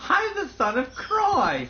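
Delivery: high, slow, fake-sobbing voice; mock-weepy parody. Friend parodying the son of God line, fake crying. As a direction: whine it like a fake cry.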